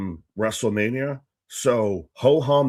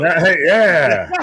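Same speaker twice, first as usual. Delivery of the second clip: mock show cheer; 3 semitones higher, 8 dB louder, slower than usual. Mimicking the Man Show ending.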